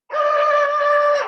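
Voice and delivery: high, loud car whine; imitated reversing whine. Storyteller imitating the car in reverse, high loud whine. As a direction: imitate a car racing backwards.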